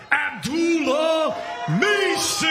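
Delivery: rising, stretched-out shout of the name; boxing announcer callout. Real, not acted. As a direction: build the name to a big finish. MC calls out a fighter.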